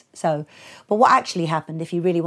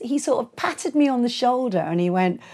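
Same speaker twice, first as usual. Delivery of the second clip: ordinary talk, nothing marked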